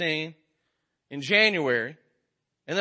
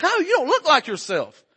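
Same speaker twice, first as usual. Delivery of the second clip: put-on surprised old lady; 9 semitones higher than usual. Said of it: "It's like".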